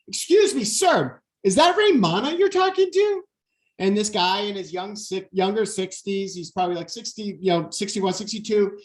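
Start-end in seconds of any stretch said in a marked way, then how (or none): 0.1-3.2 loud, high call across the beach; yelling over. Retold or imitated. Calling across to a stranger, loud and high. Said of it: "So I yell over".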